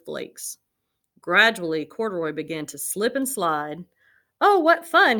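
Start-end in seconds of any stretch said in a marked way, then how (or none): 4.4-5.2 much higher, louder cry of joy; delighted. A narrator's reading. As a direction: squeal with glee. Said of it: "he said with a smile".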